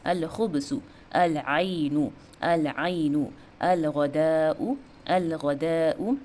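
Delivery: slow, deliberate word list with every "अल" fully sounded; teacher's syllable-by-syllable Arabic model, clear "अल"; clear lam. Shown as a demonstration. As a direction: draw out "आइनु" and "गदाउ" after a distinct l. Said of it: "लाम का sound कितना clear है".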